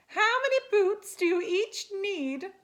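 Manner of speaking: high, loud godmother voice; hairy godmother. A narrator's reading. Godmother questions the spiders about boots, high loud demand. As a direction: ask it grandly, high and loud. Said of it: "she asked".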